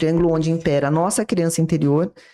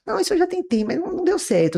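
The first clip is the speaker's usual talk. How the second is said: much higher, complaining victim voice; mock high whiny complainer; 13 semitones higher, faster than usual. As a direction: whine like someone who never wants to get better. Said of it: "lugar de vítima".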